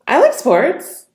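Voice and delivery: slow, loud, very high rising surprise; high-pitched surprise. Shown as a demonstration. Coach demonstrating tonality on a sample line, surprised. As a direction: shoot the pitch up in astonishment. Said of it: "High-pitched. Surprised".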